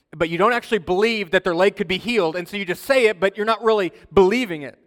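loud, emphatic pulpit delivery; forceful preaching. Real, not acted. Pastor warns congregation against their own strength, forceful preaching. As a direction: drive it home to the congregation, loudly.

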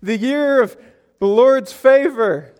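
high, put-on enthusiasm, stretched at the end; faked excitement. Congregant faking delight at Luke four, over-excited. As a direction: gush as if you understood it. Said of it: "don't want you to fake it".

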